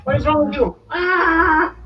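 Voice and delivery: very high, loud shriek of protest; panicked gnome shout. Acted. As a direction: yelp as a little gnome dangling by the scruff. Gnome grabbed and lifted, protests.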